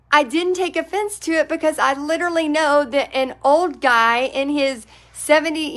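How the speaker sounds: ordinary talk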